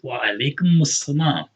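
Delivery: "Wa alaikumus salam" with a held m flowing into us; linked vowel. Shown as a demonstration. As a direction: glide the dhamma into salam. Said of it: "That dhamma goes over to the seam like that".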